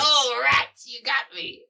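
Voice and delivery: very high, loud whine of defeat; cartoon villain defeat. Defeated rat monster admits loss to crab hero, high and loud. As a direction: wail it like a beaten Saturday-morning villain.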